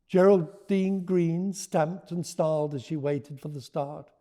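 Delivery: lively read-aloud voice; story narration. A narrator's reading. Author reading her book to listeners, lively. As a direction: read it to a roomful of children.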